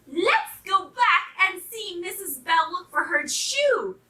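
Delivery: high, eager puppy voice. A narrator's reading. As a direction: suggest it high and keen. Pup eagerly leads the animals.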